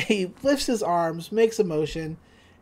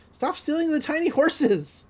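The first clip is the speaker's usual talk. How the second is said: higher, mock exasperated scolding; exasperated Dipper; 8 semitones higher than usual. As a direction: whine at her to stop. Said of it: "And he's like".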